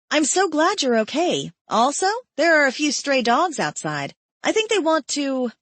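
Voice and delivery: high, bright cry of a name; excited young woman. Acted. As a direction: burst in calling his name with relief. Genesis chatters relieved.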